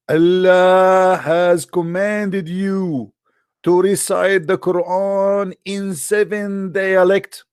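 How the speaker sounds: mock solemn quote. Preacher mocking the angel's message to Muhammad, mock-solemn and very slow. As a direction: ham up the angel's grand announcement, very slowly. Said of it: "he said to Muhammad".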